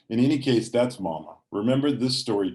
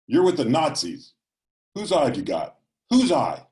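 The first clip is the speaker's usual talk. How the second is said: loud, raised accusing voice; super loud mother; 6 semitones higher, 6 dB louder than usual. A narrator's reading. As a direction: voice Mama hurling accusations at Daddy. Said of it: "but just super loud".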